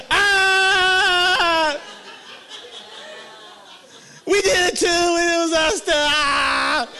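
high, loud, hammed-up wailing; mock sobbing confession. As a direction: sob it out like a caught crowd. Group tearfully confessing.

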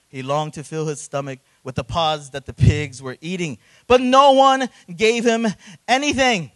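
loud, high, impassioned preaching; preaching at full force. Acted. Pastor preaching to the congregation about the prodigal son, fervent and loud. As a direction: drive the point home to the pews.

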